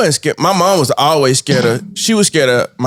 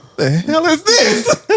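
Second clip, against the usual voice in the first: high, slow, laughing mock confusion; a pet waking up baffled; startled hamster; 13 semitones higher, slower than usual. Gerbil waking up in the landfill, high slow mock confusion.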